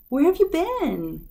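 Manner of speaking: high, slow, fond "where have you been"; missing someone. Shown as a demonstration. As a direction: ask slowly, full of missing them. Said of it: "Because you miss them very much".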